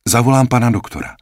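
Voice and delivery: rychle a hlasitě vyhrknuté; blurted. Acted. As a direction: vyhrkni to rychle a nahlas, vzrušeně. (sestřička vzrušeně vyhrkne, že zavolá doktora)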